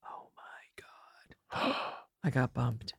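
very slow, hushed disbelief; stunned whisper. Retold or imitated. Comic learning she got bumped, horrified slow whisper. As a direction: breathe it out slowly in mock horror. Acting crushed reaction to being bumped.

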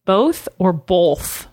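slow "both or both", the second with an L, bolth; contrasting pronunciations. Shown as a demonstration. Quizzing partner on both with an L, contrasting pronunciations. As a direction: draw out each word, add an L to the second "both". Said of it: "people say both with an L".

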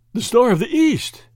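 astonished gasp. A narrator's reading. Nobleman sees the diamond produced, gasping. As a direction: cry out at the sight, astounded. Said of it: "gasped Lord Yardley".

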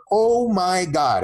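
high, slow stunned oh my god; awed exclamation. Retold or imitated. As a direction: stretch it out in disbelief. Client amazed by the pump.

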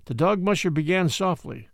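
ordinary delivery, nothing marked

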